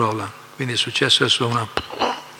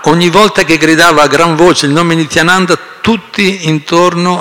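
raised voice; 4 semitones higher, 9 dB louder than usual. Real, not acted. Reads on still raised after shouting.

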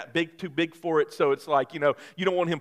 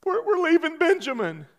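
high, tearful wife voice; 13 semitones higher, 3 dB louder than usual. Retold or imitated. Husband imitates his wife crying about leaving their son. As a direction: wail it like a worried mother. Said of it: "she said".

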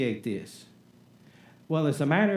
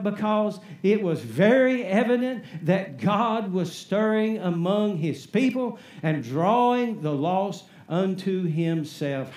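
usual speaking voice

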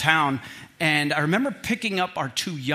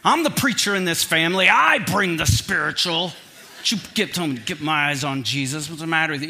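mock indignant; 4 dB louder than usual. Retorting to his son.

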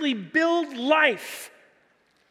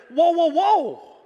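slow, higher "hold on" objection; protesting interjection; 4 semitones higher, 4 dB louder, slower than usual. Acted. Preacher voices the other thief on the cross, slow protest. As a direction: cut in to check the scoffer, drawn out.

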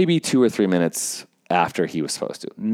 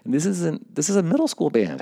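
much higher, indignant venting; a little heated; 10 semitones higher, faster than usual. Retold or imitated. Helper vents about rude band director, a little heated. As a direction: imitate heated grumbling, voice climbing high. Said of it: "I'm getting a little heated".

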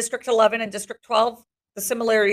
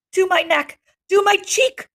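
rising eager plea, higher each time; begging; 10 semitones higher, 3 dB louder, slower than usual. Acted. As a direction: plead for more relief. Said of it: "I almost beg".

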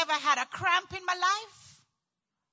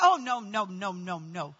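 string of noes sinking lower; dismayed refusal; 9 semitones lower than usual. Retold or imitated. Patient told she needs ten centimeters, sinking refusal. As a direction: start firm, slide down in pitch and volume.

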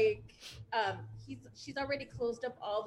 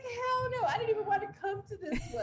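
high emphatic refusal; 10 semitones higher than usual. Retold or imitated. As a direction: refuse it shrilly, laughing. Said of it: "I was like".

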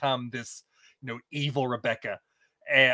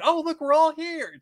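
much higher, slower mock-surprised greeting; Rebecca's fake surprise; 13 semitones higher, 4 dB louder than usual. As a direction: sing out a staged coincidence. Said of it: "parasail in and".

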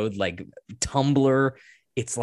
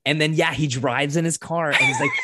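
excited; 6 semitones higher, 4 dB louder than usual. Real, not acted. Excited retelling of the climax.

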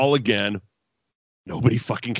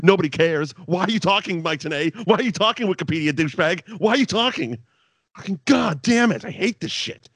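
higher, fed-up ranting at the TV; fed up with a wrestling commentator, exasperated rant; 7 semitones higher than usual. Real, not acted. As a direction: vent your frustration, pitch climbing.

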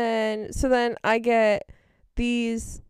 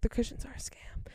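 imitating mom's hushed warning; whispered aside; 10 dB quieter than usual. Mom warning daughter in the shoe store, whispered aside. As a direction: whisper it under your breath.

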